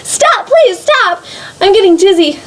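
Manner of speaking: high, frantic cry for it to stop; desperate shout. Acted. Teacup shouting at the potter to stop the wheel, high and frantic. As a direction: scream it out, spinning and dizzy. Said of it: "I shouted at the top of my lungs".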